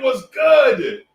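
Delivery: high and loud called-out greeting; hype greeting shout. Real, not acted. Streamer greeting a viewer, high called-out shout. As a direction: yell it across the room.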